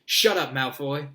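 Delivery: angry retort. A narrator's reading. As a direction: shut him down hotly. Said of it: "Harry said angrily".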